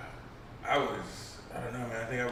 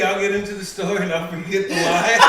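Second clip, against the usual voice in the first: laughing through the words, higher and louder; real giggling while explaining; talking through a real laugh; 5 semitones higher, 7 dB louder than usual. Real, not acted. Laughing at own forgetfulness.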